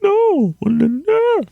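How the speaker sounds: very high, squealed protest; comic high wail of refusal; mock-panicked pleading. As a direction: cry it out in falsetto, hammed-up dread. Pleading back at his own mind.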